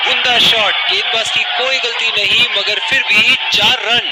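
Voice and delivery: loud, thrilled boundary call; excited call. Real, not acted. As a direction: belt out the boundary.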